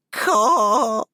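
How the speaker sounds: high, loud caw like a raven. Acted. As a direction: caw like a bird. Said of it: "said the old woman, and cackled again".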